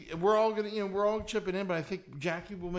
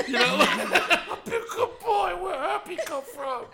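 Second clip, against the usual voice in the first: laughing; 13 semitones higher, 7 dB louder, slower than usual. Real, not acted. Laughing through the words.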